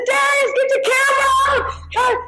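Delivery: excited shout. Retold or imitated. Calling to her husband to grab the camera, high excited shout. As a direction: yell for the camera, starstruck. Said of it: "And I said".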